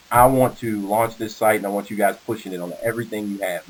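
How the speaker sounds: ordinary talk, nothing marked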